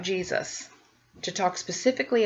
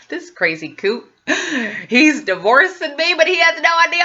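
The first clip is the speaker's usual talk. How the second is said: laughing gloat; 8 semitones higher, 6 dB louder than usual. Retold or imitated. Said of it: "I was like laughs".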